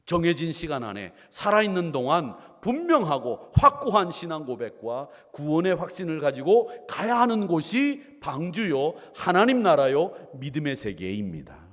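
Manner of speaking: loud, punched preaching phrases; preaching at full force. Real, not acted. Pastor urges congregation toward the ark, forceful preaching. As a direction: drive each phrase home loudly.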